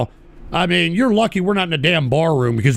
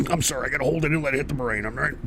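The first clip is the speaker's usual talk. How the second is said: holding smoke in; 6 semitones lower, 9 dB quieter, much faster than usual. Real, not acted.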